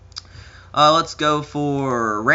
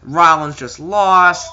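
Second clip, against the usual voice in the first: a slow, dramatic finish call; mock dramatic call; 3 semitones higher, 7 dB louder, much slower than usual. Commentator announcing the upset loss, dramatically.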